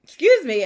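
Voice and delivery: laugh-cry, then gather herself; laughing through tears over her mother's plants; emotional laugh. Real, not acted. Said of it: "I'm getting emotional".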